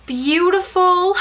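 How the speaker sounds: high, drawn-out gush of admiration; awed gushing. Real, not acted. Collector admiring the new figurine, awed gushing. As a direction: stretch the word out high, overcome.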